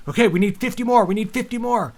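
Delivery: high, loud, greedy mock voice counting votes; a hammed-up partisan eyeing the tally; mocking Democrats watching the vote count climb; mock eager Democrat.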